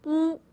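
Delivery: oo with the lips pushed out in a pout. Shown as a demonstration. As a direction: pout and hoot oo. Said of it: "I succeeded".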